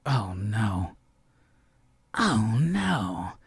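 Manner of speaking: low, hushed groaning "oh no"; mock dread. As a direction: murmur it like a reader losing hope. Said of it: "and I was like".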